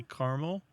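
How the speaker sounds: caramel as CAR-mul; two-syllable caramel. Shown as a demonstration. Host repeating his own habit, caramel as CAR-mul. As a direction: say caramel with AH, middle vowel dropped. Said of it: "I think I say".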